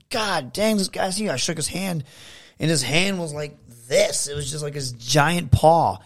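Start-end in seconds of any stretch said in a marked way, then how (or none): 0.1-1.3 higher, awed exclaim at his size; amazed. Retold or imitated. Meeting a huge wrestler for the first time, higher and awed. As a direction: marvel at how huge he is.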